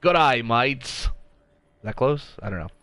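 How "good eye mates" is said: slow, drawn-out Aussie greeting; Australian accent. Acted. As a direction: have a go at a twangy Australian accent. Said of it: "commentate this entire map with Australian accents".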